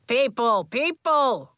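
loud calling for attention. Acted. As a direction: call the room to order. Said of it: "Coach called".

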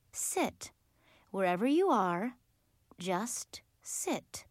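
soft, near-whispered calming order; hushed command. A narrator's reading. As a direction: settle them gently, with long pauses. Leader calms children, tells them to sit.

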